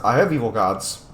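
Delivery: normal talking voice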